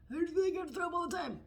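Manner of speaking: high, fast, put-on whiny patient; comic high-pitched worrier; acted anxious complaint. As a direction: rush it out in a mock-helpless voice. Acts out her anxious self.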